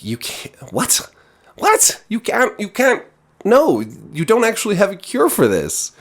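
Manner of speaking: high-pitched, spluttering disbelief; mock-flabbergasted, voice jumping way up; incredulous. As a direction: squeak out broken, baffled objections. Acting out disbelief at the villain.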